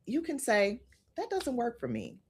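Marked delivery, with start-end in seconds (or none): 1.1-2.2 said softly, no edge at all; calm, not raised. Shown as a demonstration. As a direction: keep it soft and level. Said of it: "without raising your tone".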